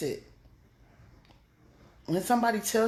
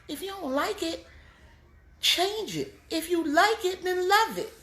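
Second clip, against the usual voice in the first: high, louder commands; rising preacher push; 11 semitones higher, 3 dB louder than usual. Real, not acted. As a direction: drive it home to the crowd.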